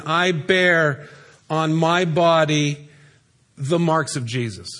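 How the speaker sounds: very slow, weighing each word; slow emphatic reading. Shown as a demonstration. Preacher slowly reading Paul's words to the congregation, emphatic. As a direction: read it slowly, letting each word land.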